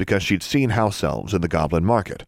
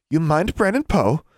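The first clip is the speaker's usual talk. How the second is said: higher-pitched lady librarian voice; prim librarian; 10 semitones higher than usual. A narrator's reading. Librarian assigning a student's detention work, prim high voice. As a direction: give orders as a librarian.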